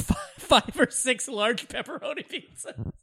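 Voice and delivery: real laughter squeezing the words; laughing through words. Real, not acted. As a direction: struggle to get it out through giggles. Cracking up at own pizza story.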